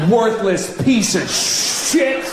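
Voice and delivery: slow mock insult. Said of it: "turn right to their face and go".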